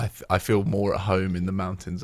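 ordinary delivery

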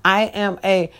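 ordinary talk, nothing marked

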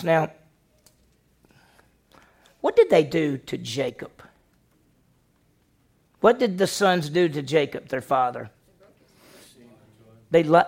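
ordinary talk

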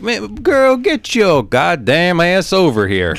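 high, slow mock Southern accent, laughing. Shown as a demonstration. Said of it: "really like a Southern accent".

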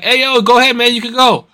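high-pitched call to wave someone on; retold calling out. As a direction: re-enact calling across to a driver. Retells waving a driver on.

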